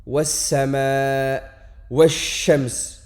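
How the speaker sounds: Was-sama et wash-shams lentement, la consonne doublée; shadda appuyée. Shown as a demonstration. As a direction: tenir le s et le sh doublés. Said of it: "et on ajoutera une shadda". (consonne doublée)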